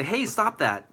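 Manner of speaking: mildly indignant, as if telling someone off; indignant protest. Pretend. Bullied kid telling the bully off, mildly indignant.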